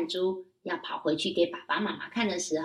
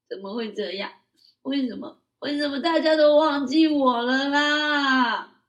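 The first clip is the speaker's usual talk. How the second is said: put-on crying child; 6 semitones higher, 7 dB louder than usual. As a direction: cry out why everyone forgot. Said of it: "一边哭". (高声童音，边哭边喊)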